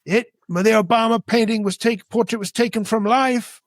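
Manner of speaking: mock objecting woman. Mimics the woman lying back at him.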